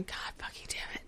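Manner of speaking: hushed whispered swearing; whispered curse. Retold or imitated. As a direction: curse under your breath. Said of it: "I'm sitting there going".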